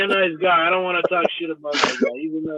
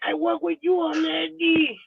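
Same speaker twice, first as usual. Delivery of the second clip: high, slow imitation of the old lady; mimicking old lady; 12 semitones higher, 5 dB quieter, slower than usual. Quoting the old lady's deal offer, high and slow. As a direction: stretch it out high like a pleading grandma. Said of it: "She's like".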